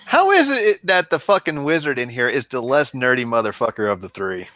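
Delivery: ordinary talk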